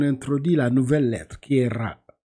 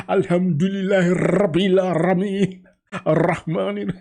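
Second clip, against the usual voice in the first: louder, higher mock Mauritanian recitation with rolled Rs; imitated Mauritanian accent; 5 semitones higher, 5 dB louder, slower than usual. Teacher mimicking the Mauritanian student at the mosque, imitated Mauritanian accent. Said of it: "essayez de faire comme la Mauritanie".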